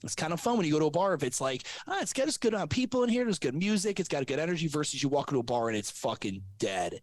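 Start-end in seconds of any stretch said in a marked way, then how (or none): 1.9-4.7 high, quick burst of approval; pleased bar-goer. Acted. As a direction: voice an impressed customer taking in a lively bar. Acting out a bar-goer pleased with the crowd.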